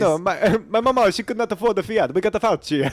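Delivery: hammed-up Italian storyteller; Italian accent. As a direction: plead in a comic Italian accent. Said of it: "Keep the Italian accent in there".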